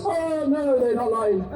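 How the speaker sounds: high sneering mock reply; mocking sarcastic. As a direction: scoff it like a know-it-all.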